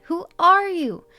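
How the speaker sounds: high, loud, stammering frightened questions; terrified. A narrator's reading. Brother facing an intruder in his room, terrified. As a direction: demand answers in a panicked high boy's voice. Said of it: "his expression terrified".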